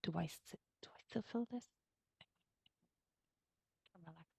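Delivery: whispered, hesitant inner question; whispered doubting thought. Retold or imitated. The anxious meditator's inner voice, whispered.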